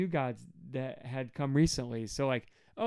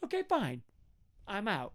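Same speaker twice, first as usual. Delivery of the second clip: mock huffy surrender; 8 semitones higher, 3 dB quieter than usual. God washing his hands of Israel, high huffy resignation. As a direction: toss it off high-pitched and walk away. God acted giving up in a huff.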